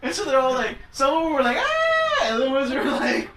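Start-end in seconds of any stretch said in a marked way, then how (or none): 1.6-2.2 high mock shriek; high-pitched scream. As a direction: imitate a startled high scream. Girls react to the flashing.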